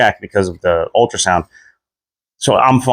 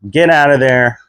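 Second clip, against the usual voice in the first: ordinary talk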